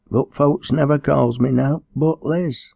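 high-pitched dialect voice; timid girl. Acted.

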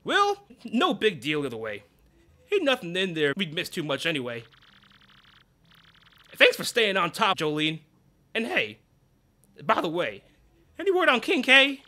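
a lightly voiced folksy boss; easygoing southern boss, perking up at the end; Grubba's voice. Boss thanking his manager, folksy and light.

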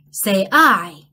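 ordinary delivery

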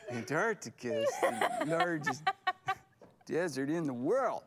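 [name]. Acted. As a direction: play a country star, cracking up. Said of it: "now I'm Garth".

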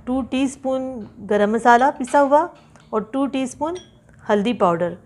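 usual speaking voice

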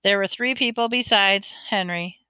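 ordinary delivery, nothing marked

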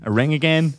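ordinary delivery

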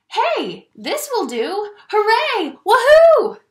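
building to a loud high whoop; delighted cheering rising to a shout; joyful cheer. Acted. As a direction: celebrate like you found relief. Lou finds the bamboo, celebrating.

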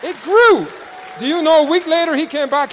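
high, loud proclamation; fervent preaching. Real, not acted. Preacher proclaiming the leg healed, loud and high. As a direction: declare the miracle.

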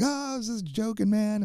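imitating a charmer in a high, light voice; high-pitched, playful mock plea to be let off; charming excuse. Drunk musician talking his way out with security, imitated high and harmless. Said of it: "talks his way out of getting kicked out".

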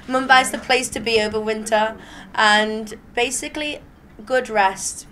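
normal talking voice

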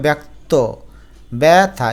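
ব্যক্ত, ব্যথা as "baek-to, bae-tha"; open "ae" vowel. Shown as a demonstration. Teacher showing j-fola, ব্যথা as "bae-tha". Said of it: "এর মতো উচ্চারণ করতে হবে".

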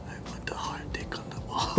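breathy hushed whisper. Pretend. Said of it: "did you just say the same thing but whisper it".

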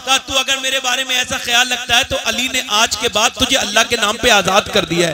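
loud, building sermon story; rousing storytelling. Acted. Preacher telling Mola Ali's tale, loud and rising. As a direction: drive the story to its climax.

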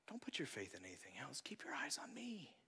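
hushed reassuring voice; whispered Jesus. Retold or imitated. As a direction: whisper it like a secret promise. Quoting Jesus gently.

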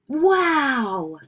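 high, loud, drawn-out exclamation; excited. A narrator's reading. Brother seeing his new bike, excited. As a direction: cry out in wonder. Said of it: "said Brother excitedly".

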